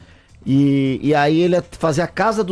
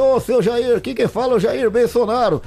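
higher-pitched politician caricature; phone the man in his own voice; Bolsonaro; 4 semitones higher than usual. Retold or imitated. Guest calls the politician live on his show, impersonating him. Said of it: "Aí eu falei".

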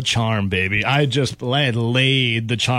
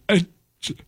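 imitating a tearful woman, slow and halting; mock crying; 4 semitones higher, slower than usual. Crying bartender tells customer about the dog, tearful. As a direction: choke it out in a put-on weepy voice. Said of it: "she starts crying".

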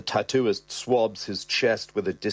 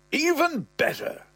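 put-on way up high, delighted old man; the old man, chuffed, in a high piping voice; old man's squeaky voice. Old dad to son, thrilled: high piping.

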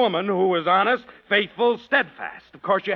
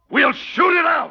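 slow, loud, higher challenge; shouted challenge; 6 semitones higher, 4 dB louder than usual. Acted. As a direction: throw down the duel. Challenge to duel.

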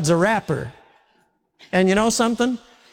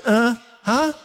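higher, questioning huh; feigned not hearing; 5 semitones higher than usual. Acted. Son stalls mom over the garbage, feigning he didn't hear. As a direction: play dumb with a raised, questioning tone.

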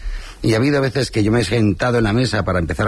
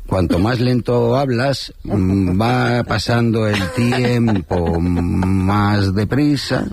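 deliberately slow; much slower than usual. Shown as a demonstration. Comic host demonstrating how to kill airtime, deliberately slow. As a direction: drag the words out very slowly. Jokes slow talk makes time pass.

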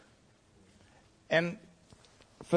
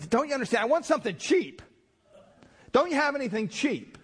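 exasperated customer; 10 semitones higher than usual. Retold or imitated. Cheap husband whining at the perfume clerk, exasperated. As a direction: whine like a cheapskate fed up. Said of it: "And he just".

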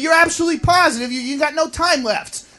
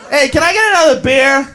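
ordinary talk

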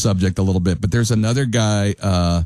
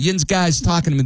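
put-on Pittsburgh accent, pitched up; "Yin's guy's" in Pittsburgh twang, raised; 8 semitones higher than usual. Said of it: "only done in a Pittsburgh accent".

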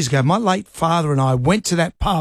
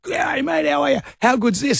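hammed-up eager greeting; 9 semitones higher than usual. Retells his eager greeting to bouncer.

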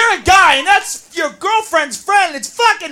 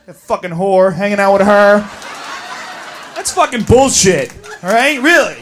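low seething grumble; 10 semitones lower than usual. Acted. Bossy friend seething, low.

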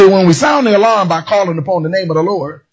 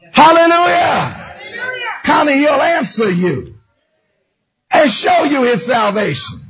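fiery preaching; 3 semitones higher than usual. Acted. Preacher calls members by name with God's promise. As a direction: call each one out with the promise.

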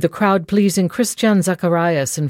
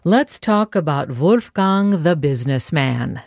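ordinary talk

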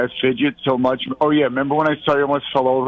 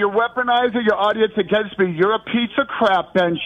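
mock angry rant; 7 semitones higher than usual. Caller rants at host.

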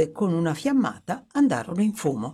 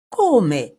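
sharp, louder cry of surprise; astonished exclamation; 2 semitones higher, 6 dB louder than usual. A narrator's reading. Young man startled to hear the others are ruined, exclaiming. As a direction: blurt it out in shock. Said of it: "esclamò il giovane".

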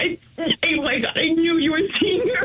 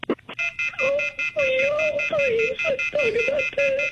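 higher, tearful begging; desperate pleading; 9 semitones higher, slower than usual. Real, not acted. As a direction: plead to keep him on the line. Wife pleading as husband leaves.

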